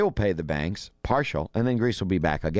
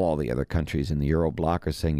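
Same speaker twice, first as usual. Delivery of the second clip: usual speaking voice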